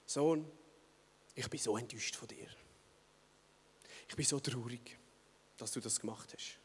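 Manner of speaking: hushed, sorrowful whisper; disappointed father. Retold or imitated. Father whispering his disappointment to his son, soft and sorrowful. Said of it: "Und der Vater sagt".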